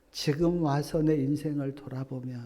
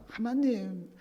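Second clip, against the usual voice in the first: loud, higher, whining grumble; complaining to God; 9 semitones higher, 9 dB louder than usual. Retold or imitated. Mixed crowd complains to God about the road, loud whiny grumbling. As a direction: voice the crowd's bitter protest. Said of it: "악한 말로 하나님을 원망합니다".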